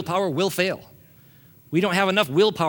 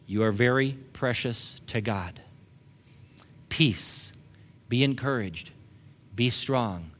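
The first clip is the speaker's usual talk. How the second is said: lowered, quiet voice with spaced phrases; soft scripture reading; 7 semitones lower, 7 dB quieter than usual. A narrator's reading. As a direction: read the verse softly and low to the congregation.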